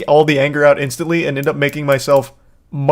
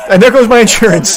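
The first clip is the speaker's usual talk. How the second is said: high, loud whiny mockery; sarcastic whine, as the angry driver; 7 semitones higher, 7 dB louder than usual. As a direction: streamer voicing the driver tearing things up, loud whine. Mocking the driver in the clip.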